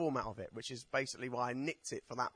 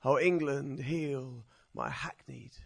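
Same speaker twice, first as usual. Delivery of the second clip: slow, declaimed verse with raised pitch; poetry recital; 8 semitones higher, 9 dB louder, slower than usual. Acted. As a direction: recite it as an impassioned plea, drawing out each phrase. Recites own poem to audience.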